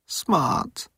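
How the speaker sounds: high-pitched, glum inner voice; miserable thought. A narrator's reading. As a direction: think it miserably to yourself. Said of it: "thought Moan miserably".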